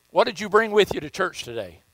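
ordinary talk

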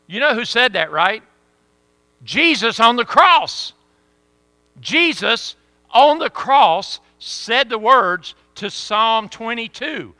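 loud, high, slow preacher's climax; fervent preaching. Acted. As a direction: drive it home to the congregation. Preaching at full force.

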